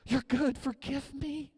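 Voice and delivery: high, pleading adoration; crying out to God. Acted. Preacher acting believers bowed before the Lord, pleading cry. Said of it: "we're gonna cry out to him".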